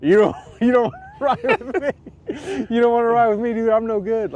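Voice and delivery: waving off riders with a laugh; laughing refusal. Retold or imitated. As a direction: laugh off the ride request. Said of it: "And I'm like".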